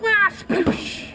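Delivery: high yell into a noisy splash; acted plunge with splash effect; leaping splash. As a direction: cry out, then imitate hitting the water. Makes a splash into water.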